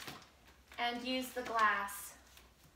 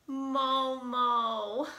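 usual speaking voice

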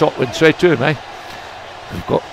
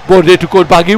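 ordinary delivery

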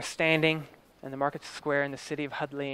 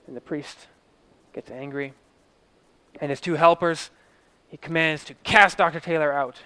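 normal talking voice

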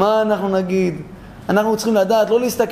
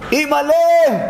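shocked exclamation; 8 semitones higher, 4 dB louder, slower than usual. Acted. Delegation shocked at her loose hair.

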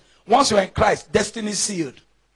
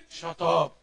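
dismissive cut-off; 9 semitones higher, 5 dB quieter than usual. Real, not acted. Cuts off mocked useless prayer.

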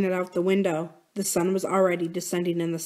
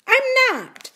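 much higher, louder protest; insistent denial; 5 dB louder than usual. A narrator's reading. Drew denies to Corinne that she is scared, insistent. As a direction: insist against the accusation. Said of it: "Drew insisted".

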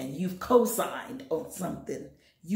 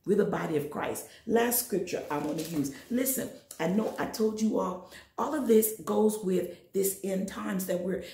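usual speaking voice

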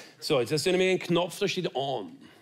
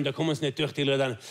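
usual speaking voice

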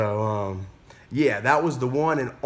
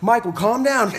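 high, raised-voice retold order; urgent calming; 9 semitones higher, 5 dB louder than usual. Teacher to panicking learner driver, loud urgent calming. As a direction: cut through someone's panic, loud and high.

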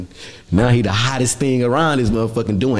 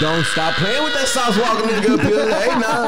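hype shout-out; 10 semitones higher than usual. Real, not acted. Shouting out [name].

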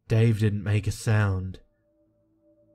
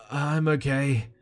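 higher, mildly feeble voice; weak answer; 5 semitones higher than usual. A narrator's reading. Fallen boy reassuring his friend from the hole, weak voice. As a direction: answer feebly, pitched up a little. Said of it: "he answered weakly".

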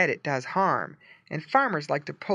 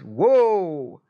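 calling whoa; 2 semitones higher, 7 dB louder than usual. Retold or imitated. Driver stopping horses.

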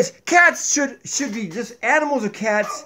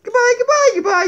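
high, loud puppet goodbye; Donkey's goodbye; 7 dB louder than usual. Acted. Donkey waves goodbye to viewers, high puppet voice.

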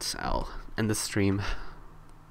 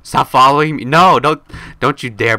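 mock yelling; 4 semitones higher, 11 dB louder than usual. Telling player to stop following.

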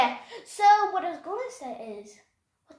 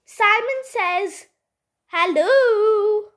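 slow, stretched Simon says hello; drawn-out hello; 2 semitones higher, 3 dB louder, much slower than usual. Real, not acted. Talking to Alexa in Simon says, drawn-out. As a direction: draw out the hello, louder at the end.

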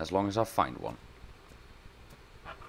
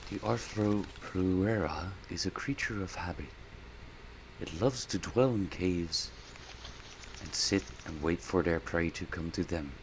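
documentary narrator; 3 semitones lower, 5 dB quieter than usual. Acted. Gamer describing the creature as a nature documentary narrator.

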